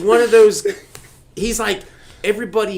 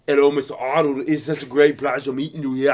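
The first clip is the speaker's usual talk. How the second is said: mock Austrian accent, slightly lowered; Arnold Schwarzenegger; 4 semitones lower, 4 dB quieter, faster than usual. Fan meeting his idol, imitating his Austrian accent. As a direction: greet politely in a put-on Austrian accent. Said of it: "I'm gonna imitate them as I meet them".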